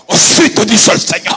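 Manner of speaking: loud booming prophetic proclamation; mocking pulpit boom. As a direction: switch into a thundering holy voice. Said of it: "cuando van a profetizar".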